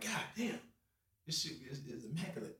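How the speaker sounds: soft, hushed awe; breathless at the house; awed hush. Retold or imitated.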